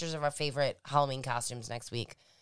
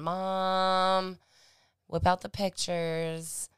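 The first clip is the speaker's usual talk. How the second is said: higher, drawn-out call; calling Mom; 3 semitones higher, much slower than usual. Real, not acted. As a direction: hold the word out, calling for Mom.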